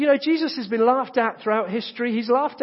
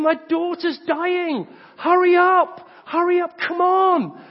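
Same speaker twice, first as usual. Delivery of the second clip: higher, louder urgent plea for help; desperate pleading; 8 semitones higher, 4 dB louder, slower than usual. Retold or imitated. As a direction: beg him to hurry, a father at his wits' end. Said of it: "he's desperate".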